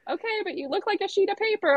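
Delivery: high, fretful imitated voice; retold anxious objection; worried mother. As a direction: protest with motherly concern.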